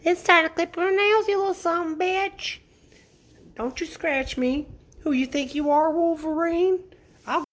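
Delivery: very high silly voice scolding a pet; squeaky cat-scolding voice. Pretend. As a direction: squeak at the cat like a cartoon critter.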